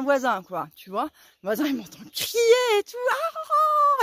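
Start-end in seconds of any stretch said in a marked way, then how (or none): 3.1-4.0 high excited ah cries; high yelling cries. Retold or imitated. Calling out so the neighbour hears her. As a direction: let out high hailing cries. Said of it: "comme ça".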